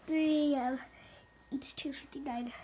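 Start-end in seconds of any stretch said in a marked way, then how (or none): 1.5-2.5 hushed whisper so parents stay asleep; nervous night vlog whisper. Real, not acted. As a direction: whisper the time, tense and quiet.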